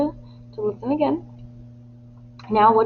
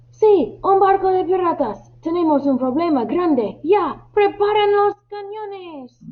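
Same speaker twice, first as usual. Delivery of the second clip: high, insistent alarm; urgent shout; 10 semitones higher than usual. Acted. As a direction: urge the frightened crew to act. Alarmed sailor answers the crew. (grito urgente y agudo)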